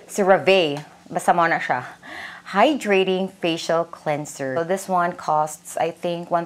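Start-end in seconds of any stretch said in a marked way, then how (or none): 0.1-0.8 CeraVe falling on a final "vay"; CeraVe as ser-a-VAY. Shown as a demonstration. Vlogger settling on a name, CeraVe as "ser-a-VAY". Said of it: "if it's CeraVe or CeraVe".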